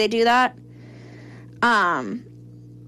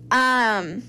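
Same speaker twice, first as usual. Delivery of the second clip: ordinary delivery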